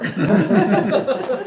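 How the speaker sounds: a big burst of laughter at her own joke; loud laugh. Real, not acted. Laughing at her own traffic story, big laugh. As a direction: laugh out loud, amused at herself.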